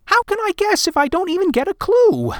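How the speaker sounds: put-on high boy, pleading; a boy asking for his marble; child voice. A narrator's reading. Boy voice in story.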